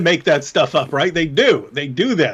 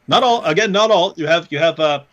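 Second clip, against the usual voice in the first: ordinary talk, nothing marked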